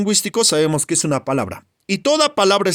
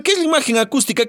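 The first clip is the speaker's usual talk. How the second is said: high, quick student question; student's question; 6 semitones higher, faster than usual. Acted. Puzzled student asks the teacher, high and quick. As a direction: ask it fast and high, like a puzzled pupil.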